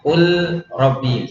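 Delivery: "kul robbi" with a distinct l before the r; separate lam. Shown as a demonstration. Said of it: "Lam sendiri, sukun". ("kul robbi", lam berdiri sendiri)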